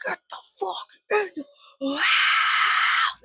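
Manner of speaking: high, raspy screech with a long held last word; very slow, shrieked metal-style imitation; mock death metal scream. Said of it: "a good little death metal remix".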